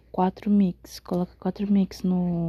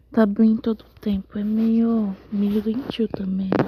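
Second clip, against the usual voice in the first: whispering. Real, not acted. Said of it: "eu tô sussurrando porque eu tô com sono".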